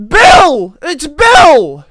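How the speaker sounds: high, very loud yell; panicked scream. Acted. Shaken kid giving his name, screaming in panic. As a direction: scream it out to make the shaking stop.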